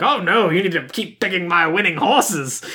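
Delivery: lightly put-on rich gentleman voice; a hint of old-money affectation; Thurston Howell. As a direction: do a mild caricature of a pompous dad. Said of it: "exactly, like Thurston Howell".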